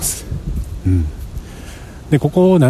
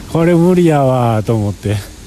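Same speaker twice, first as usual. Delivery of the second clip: ordinary talk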